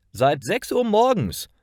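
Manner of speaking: high, perky answer; chipper early riser. A narrator's reading. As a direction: answer brightly, pitched well up.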